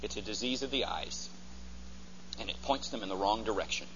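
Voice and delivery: ordinary talk, nothing marked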